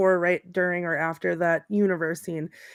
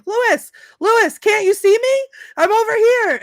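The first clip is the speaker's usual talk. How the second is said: high, loud hollering for attention; calling out; 13 semitones higher, 7 dB louder than usual. Retold or imitated. Uncle calling his nephew from the car, mock excited holler. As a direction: sing out like an embarrassing parent across a car park.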